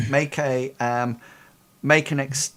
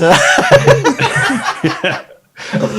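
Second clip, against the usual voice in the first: high, loud and hurried through laughter; cracking up while apologising; real laughter; 8 semitones higher, 10 dB louder, faster than usual. Real, not acted. Blunder sets everyone laughing.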